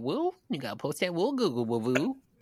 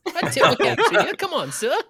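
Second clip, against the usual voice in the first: high, laughing Cockney; Cockney accent; 13 semitones higher, 7 dB louder than usual. Acted. The harbinger salutes him in Cockney, laughing. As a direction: tip your cap in a Cockney accent. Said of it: "now he's gotten Cockney".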